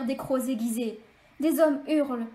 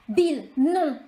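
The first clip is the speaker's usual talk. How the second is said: loud sharp cry; cried out; 6 dB louder than usual. A narrator's reading. Clark stopping Bill from shooting, crying out. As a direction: stop him with a loud cry. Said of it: "se retourne et s'écrie".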